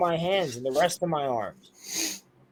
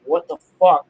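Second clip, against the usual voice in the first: retold yell; 7 dB louder than usual. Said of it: "Me yelling".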